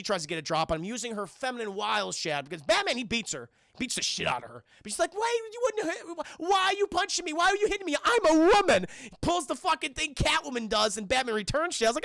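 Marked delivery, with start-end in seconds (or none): 5.2-8.8 high, louder mock-hurt protest; hammed-up indignant whine, building louder; indignant plea. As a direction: play the wronged woman, pitched up high. Harley pleading with Batman in retelling.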